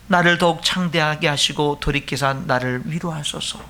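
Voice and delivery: low, measured prayer reading; scripture reading. Acted. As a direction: read the psalm solemnly, evenly pitched. Reads the psalm prayer aloud.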